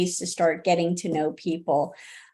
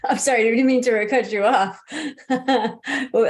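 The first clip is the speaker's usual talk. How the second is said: apologizing through a laugh; quick, amused apology with real laughter; slightly higher, laughing as you cut in; 4 semitones higher, 4 dB louder than usual. Real, not acted. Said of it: "I was laughing because".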